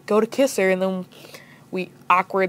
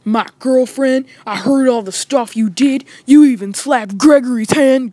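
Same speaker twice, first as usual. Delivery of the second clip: put-on tough-guy voice; confronting boyfriend; 4 semitones higher, 4 dB louder than usual. Boyfriend confronting narrator at school, mock tough accusation. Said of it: "he's like".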